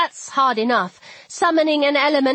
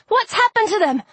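very high, alarmed question; urgent high-pitched alarm; yelping; 10 semitones higher, 3 dB louder than usual. A narrator's reading. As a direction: ask it in a panicked yelp. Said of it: "I yelped".

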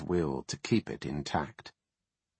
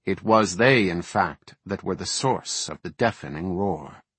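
ordinary delivery, nothing marked

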